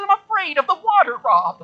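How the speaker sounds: put-on high, pleading little old lady voice; frightened old lady. Preacher voicing Tony telling Rob she fears water, high and timid. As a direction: confess the fear like a timid grandma.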